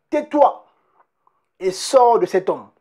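Jesus commanding the demon to be silent; Jesus rebuking. Retold or imitated. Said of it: "Jésus le menaça".

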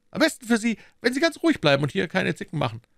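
high, firm official voice; the inspector keeps the arrested man in check; inspector's voice. Acted. Inspector warns the prisoner.

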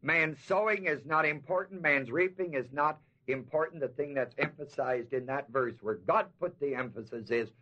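usual speaking voice

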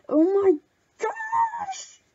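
oh-my-gosh squeal, pitch shooting up; high thrilled shriek; excited squeal. Real, not acted. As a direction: shriek with delight, soaring very high. Thrilled reaction to a gift.

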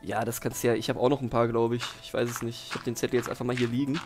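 ordinary delivery

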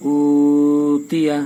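উতিয়া with the opening উ drawn out slowly; madd lengthening. Shown as a demonstration. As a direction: hold the উ of উতিয়া long. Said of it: "আর এটার ভিতরে প্রথমটায় টান". (উতিয়া প্রথম উ টেনে)

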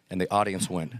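ordinary talk

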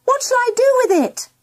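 put-on quick, very high girl's call; high, hurried shout without much volume. A narrator's reading. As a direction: call out an urgent question, pitched high. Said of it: "shouted Mandy".

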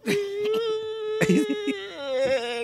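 comic wail. Acted. Drunk wakes, dream lost.

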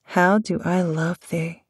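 normal talking voice

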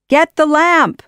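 high, loud command; urgent shout. A narrator's reading. Aladdin shouts to Jasmine mid-fight, urgent and high. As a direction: shout it across to someone. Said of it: "Aladdin shouted to Jasmine".

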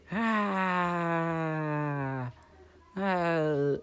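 high yelp of pain; high scream. Pretend. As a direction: cry out when struck. Character hit, cries out.

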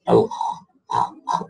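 Al-kh scraped, low and breathless; low grating kh demo. Shown as a demonstration. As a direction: rasp out the kh. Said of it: "type of a grating sound".

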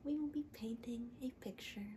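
soft, hushed, gentle TV painter; soft painting show host. Acted. A painting host soothing viewers, soft and hushed.

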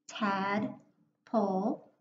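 slow, each syllable pulled apart; chunked into syllables. Shown as a demonstration. Teacher sounding out Tadpole to young readers, slow and chunked. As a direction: stretch it out syllable by syllable. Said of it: "breaking it into my syllables".

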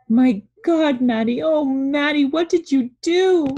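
loud, high-pitched horrified cry; anguished crying out. A narrator's reading. Mother cries out to Maddie over what was done, anguished. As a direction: wail it out at your child, loud and high. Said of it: "She cries".

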